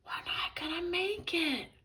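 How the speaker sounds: high, airy stage whisper; high-pitched hushed worry. A narrator's reading. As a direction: whisper it in despair. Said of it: "whispered George".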